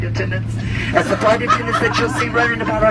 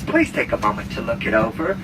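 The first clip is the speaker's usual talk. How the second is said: ordinary delivery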